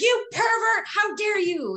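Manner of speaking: very high, indignant shriek; mock outraged heel. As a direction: play the offended heel. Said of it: "And I was like".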